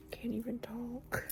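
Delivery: whispered to herself. Real, not acted. Losing her words while telling viewers, whispering. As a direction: mutter it under your breath in a whisper. Whispered self-aside mid-story.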